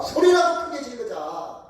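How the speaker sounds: lifted, determined inner voice. Retold or imitated. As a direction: voice his resolve high and firm. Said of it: "내가 할 수 있는 방법이 뭘까?".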